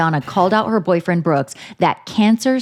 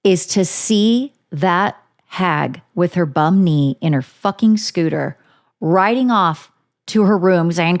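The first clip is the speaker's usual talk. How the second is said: ordinary talk, nothing marked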